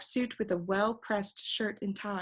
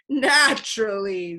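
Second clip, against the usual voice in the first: cackling; 9 dB louder, slower than usual. A narrator's reading. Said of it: "the bird cackled".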